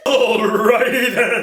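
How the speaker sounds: high slow cartoonish voice; drawn-out goofy catchphrase; celebrity impression.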